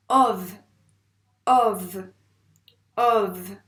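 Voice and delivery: "Ov" loud, with a buzzed final v; loud, careful dictation of "Ov"; dictated syllable. Shown as a demonstration. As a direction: punch the open o of "Ov" and hold the v.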